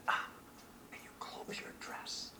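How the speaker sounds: hushed whispered aside. Retold or imitated. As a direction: whisper it discreetly, unvoiced. Tanzanian girl whispers to Linda.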